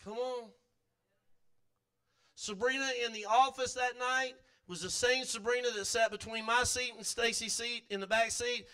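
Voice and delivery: normal talking voice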